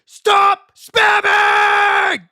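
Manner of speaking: very slow, high, loud yell; shouting. Real, not acted. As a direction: drag out an angry yell. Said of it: "never heard you raise your voice like that".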